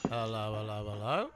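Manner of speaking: low, soft drone rising at the end; playing the detective. Would-be sleuth searching a suspect, mock detective. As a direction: snoop like a comic sleuth, ending on a questioning lift. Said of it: "Sherlock Holmes?".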